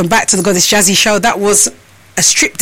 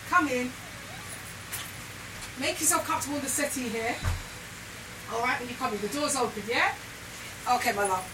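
distant, much softer, higher-pitched welcome; away from the mic; 4 semitones higher, 19 dB quieter than usual. Real, not acted. Host letting her guest in at the door, away from the mic. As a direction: call a greeting from across the room, off-mic.

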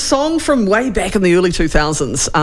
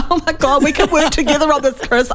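mocking gushing politician; 8 semitones higher than usual. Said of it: "They were like".

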